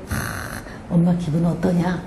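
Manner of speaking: sneaking home, a wary whispered check on mom; hushed and careful, testing the mood; cautious hush. Retold or imitated. Child creeping home asks about mom, in a hushed wary voice. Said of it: "이러고 들어가고".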